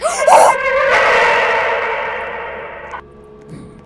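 sharp scared scream; terrified gasping scream. Real, not acted. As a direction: scream in fright. Jump scare in horror game.

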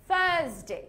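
British থার্সডে with silent R; British-style tongue-out TH and long open vowel; British accent. Shown as a demonstration. Teacher demonstrating Thursday, British accent. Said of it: "British অ্যাকসেন্টে আমরা বলি".

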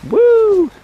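loud, high whoop; excited whoop. Real, not acted. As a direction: whoop while swinging. Shout on rope swing.

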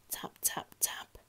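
very soft whispered taps; tiny hushed taps; timid soft knocking. A narrator's reading. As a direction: barely sound each tap. Quiet man knocks timidly.

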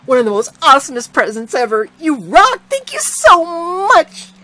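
put-on a delighted child overflowing with gratitude; thrilled thanks. A narrator's reading. Girl thrilled by present.

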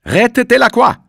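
desperate cry. A narrator's reading. Juliette begging them to save Delacroix, desperate scream. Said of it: "rief Juliette".

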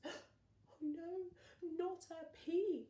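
high, slow alarmed cry; gasping horror. Acted. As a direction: gasp it in mock horror. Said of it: "everyone gasped".

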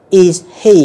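"Is he" with the H sounded; full H kept. Shown as a demonstration. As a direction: say "Is he" as two separate words. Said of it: "một cái âm rất là yếu".

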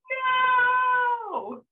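very high, loud, held mock wail; drawn-out retold scream. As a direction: hold a long high cry of horror, hammed up. Re-enacting the earlier horror.

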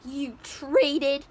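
loud, slow outraged scolding; furious mom. Retold or imitated. Mother scolding Jack over the beans, loud outrage. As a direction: blast him for trading the cow. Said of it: "the mother is like".

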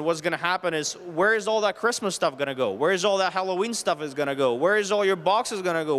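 ordinary delivery

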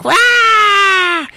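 high, loud retold scream; witch's death shriek. Witch struck in the back, mock shriek. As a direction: mimic her shrieking as she's struck. Witch hit by thrown weapon.

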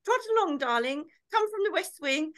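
high, singing grand-lady call; mock-posh mum. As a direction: summon her as if across a mansion. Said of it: "we didn't do posh".

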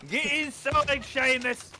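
put-on loud, high slurred Irish drunk; drunk Irishman. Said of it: "the drunk Irishman in there".

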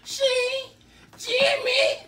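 high shrieking through laughs; laughing shout. Real, not acted. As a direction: yell while cracking up.

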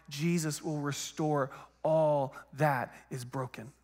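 ordinary talk

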